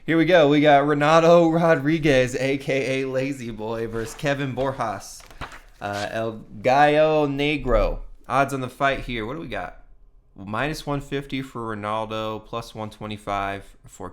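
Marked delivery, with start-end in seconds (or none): 0.1-8.0 ring announcer. Acted. Vlogger introduces the next fight to viewers, loud announcer style. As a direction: hype up the matchup like a ring announcer.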